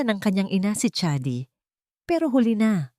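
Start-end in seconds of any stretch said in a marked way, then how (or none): none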